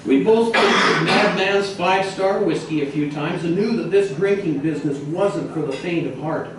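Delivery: ordinary delivery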